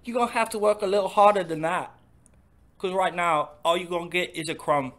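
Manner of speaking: cheeky teasing English parody; mock English accent. Taunting his friend in a mock English accent. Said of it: "our terrible English accents".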